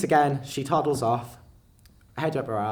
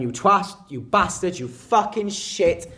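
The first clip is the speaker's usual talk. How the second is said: loud high yell, slowing on the last; shouting insults; 7 semitones higher, 6 dB louder, slower than usual. Retold or imitated. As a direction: hurl each insult down the street. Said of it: "I'm shouting".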